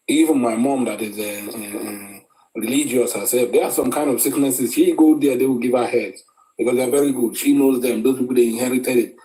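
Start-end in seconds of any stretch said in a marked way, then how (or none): none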